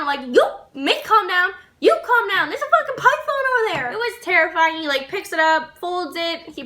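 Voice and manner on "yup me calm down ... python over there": higher, heated comeback, climbing as it goes; retold indignant retort. She answers manager telling her calm down, retold indignation. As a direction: fire it back in mock outrage.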